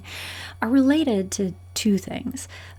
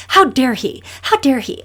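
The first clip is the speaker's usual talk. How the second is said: very loud, higher indignant cry; outraged yelling; 5 semitones higher, 9 dB louder than usual. Acted. Shoved person fuming at the stranger, loud outrage. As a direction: shout your disbelief at him. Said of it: "yelling and being so upset".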